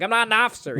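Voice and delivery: retold panicky objection; freaking out. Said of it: "he's, like, freaking out".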